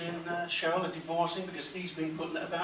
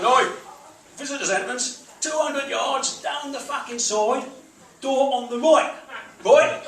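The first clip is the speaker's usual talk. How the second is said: loud, higher, slow Cockney barking; loud Cockney bouncer voice shouting directions; Cockney geezer; 7 semitones higher, 10 dB louder, slower than usual. Retold or imitated. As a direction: bark it like a Cockney doorman. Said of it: "this big Cockney geezer said".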